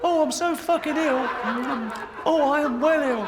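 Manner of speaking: high, loud, mocking moan of illness; mock whiny sufferer. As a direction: camp it up as a self-pitying invalid. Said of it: "How is that a disease?".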